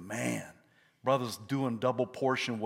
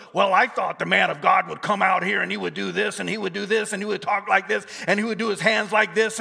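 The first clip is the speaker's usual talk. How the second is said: mildly ranting, run-on complaint; play-acted huffy protest, a touch louder; mock indignation; 8 semitones higher, 8 dB louder than usual. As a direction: imitate an offended man listing grievances. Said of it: "Naaman is furious".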